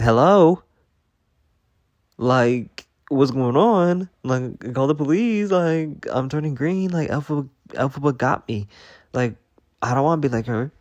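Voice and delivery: high, loud, frantic call for help; frantic panic. Pretend. To the cops after turning green, frantic and high.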